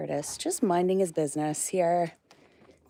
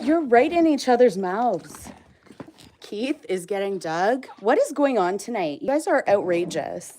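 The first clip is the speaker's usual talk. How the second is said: louder, raised voice at the rowdy animals; loud excited scolding; 3 semitones higher, 10 dB louder than usual. Real, not acted. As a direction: call out over the noisy pen. Animals crowding each other at feeding.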